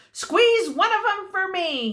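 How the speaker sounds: put-on high, loud call from an old man at the door; old rancher calling out. A narrator's reading. Old rancher calling after the departing cowhand, loud and high. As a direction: send the young rider off with a hearty call. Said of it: "he says".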